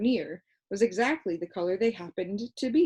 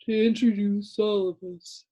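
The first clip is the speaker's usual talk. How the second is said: sleepy Lithargian; 3 semitones higher, 7 dB louder than usual. A narrator's reading. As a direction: drone it lazily like a half-asleep creature. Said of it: "the creature went on".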